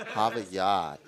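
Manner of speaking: Boston accent, dropping the r's. Shown as a demonstration. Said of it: "I used to be able to do the boss".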